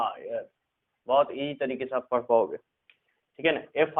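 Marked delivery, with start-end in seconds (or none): 0.0-0.5 teacher gently sounding out आयर; soft demo of ire sound. Shown as a demonstration. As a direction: say आयर softly as one smooth ire sound. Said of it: "आयर करके पढ़ना है".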